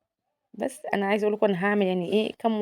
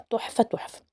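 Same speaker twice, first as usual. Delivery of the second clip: breathy whisper of delight; 6 dB quieter than usual. Real, not acted. Savouring the taste.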